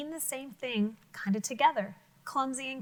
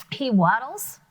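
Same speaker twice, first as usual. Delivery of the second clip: He waddles, rising and louder; stress on waddles; 8 dB louder than usual. Shown as a demonstration. Teacher rereading to children, stressing "waddles". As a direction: lean into "waddles" with a rising lift. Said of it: "Let's read those again".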